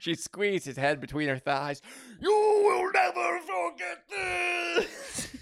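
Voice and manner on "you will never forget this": very high, drawn-out screech; hammed-up shrieking yell. A narrator's reading. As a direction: screech it out as long as it goes. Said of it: "she yelled".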